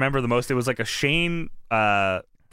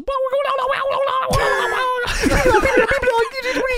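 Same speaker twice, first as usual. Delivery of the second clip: high loud laughing Thornberry squawk; Wild Thornberry yell; 4 dB louder than usual. Retold or imitated. Host imitating Monty Brown yelling at the baby, loud and high. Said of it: "he looks down at the baby and he goes".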